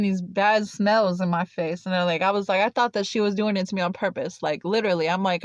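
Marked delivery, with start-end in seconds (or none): none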